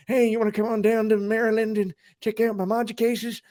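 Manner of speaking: put-on high old-timer with a country twang; eighty-year-old man. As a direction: invite a pal over as a hammed-up old man. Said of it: "let's say you're eighty".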